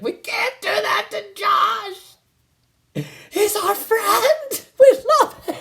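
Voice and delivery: put-on very high, slow, over-the-top pleading kid voice; melodramatic kid. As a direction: ham it up like a kid who's seen too many movies. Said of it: "really melodramatic".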